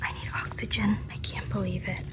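breathless, excited whisper. Real, not acted. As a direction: whisper it, overwhelmed by the find. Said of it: "Usually I'm whispering out in public".

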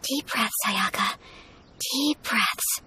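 hushed, steadying words under her breath; self-calming whisper. Real, not acted. Nervous Ayaka whispering to herself before inviting the Traveler. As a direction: quietly talk yourself down before asking.

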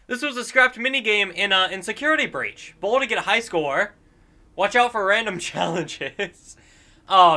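usual speaking voice

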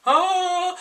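sudden high yelp of shock; startled yelp. Retold or imitated. [name] yelps as aunt shoves pill into his mouth. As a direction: jump at the shock.

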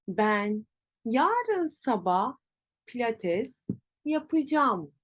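yapacağım as ya-pı-jam; spoken contraction. Shown as a demonstration. Teacher varies the example, yapacağım as yapıcam. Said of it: "Nasıl telaffuz edeceğiz?".

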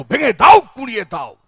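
loud protest-slogan bark, then it drops off; shouted street-march slogan. Retold or imitated. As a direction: yell it like a rally slogan. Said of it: "খালি একটাই কাজ ছিল".

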